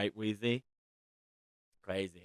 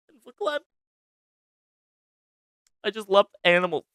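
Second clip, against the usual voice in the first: getting emotional; 9 semitones higher, 5 dB louder, faster than usual. Real, not acted. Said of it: "I'm getting really emotional".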